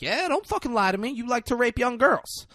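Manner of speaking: high-pitched retold interrogation; mock accusing; acted menacing accusation. Retold cop menacing a suspect.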